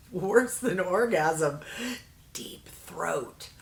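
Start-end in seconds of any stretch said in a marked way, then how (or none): 2.3-3.5 hushed, voiceless aside; embarrassed whisper. Real, not acted. Vlogger reveals a risqué blush shade name to viewers, in a whisper. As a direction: whisper it like a naughty word.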